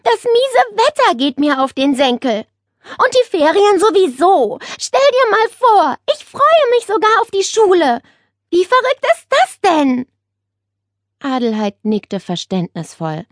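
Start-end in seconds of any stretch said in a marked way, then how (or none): 0.0-10.1 high, worked-up griping; complaining. A narrator's reading. Nele to her aunt about weather and holidays, griping. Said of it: "beschwerte sich Nele".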